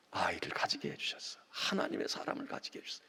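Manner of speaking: whispered, fast, in awe; hushed awe. Acted. Preacher voicing Mary, whispered in awe. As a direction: breathe it like a secret blessing.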